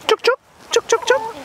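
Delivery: high sing-song chook call to hens; calling chickens. Real, not acted.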